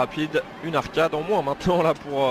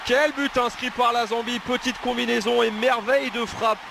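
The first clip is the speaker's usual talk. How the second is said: excited sports commentary; 7 semitones higher than usual. Real, not acted. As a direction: shout the goal, lifted high. Second goal call.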